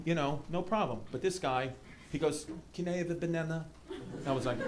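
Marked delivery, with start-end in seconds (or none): 2.7-3.6 soft, put-on French accent. French player asking the attendant for fruit, soft French accent. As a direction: lightly imitate an entitled French player. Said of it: "like, French".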